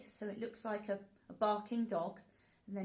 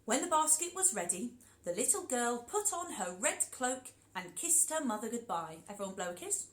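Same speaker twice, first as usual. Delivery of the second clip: plain reading voice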